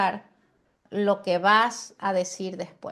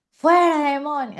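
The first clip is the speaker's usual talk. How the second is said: high, loud cry with a laugh; hammed-up deliverance yell; mock exorcism shout; 6 semitones higher, 7 dB louder than usual. Shown as a demonstration. Teacher mocks shouting in a person's ear during ministry, laughing yell. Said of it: "no vamos a predicarles en la oreja".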